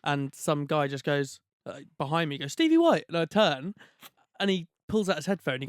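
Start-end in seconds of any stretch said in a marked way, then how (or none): 2.5-3.1 high, loud call across a shop; calling out a name. Retold or imitated. Fan behind him in a shop calls out his name, high and loud. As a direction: shout his name to get his attention.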